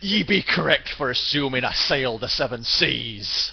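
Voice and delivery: loud, drawn-out pirate "Yar" and boast; mock pirate. Streamer jokingly answering piracy accusers, mock pirate. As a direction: growl it like a hammy pirate, slow and loud. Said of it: "I'll go".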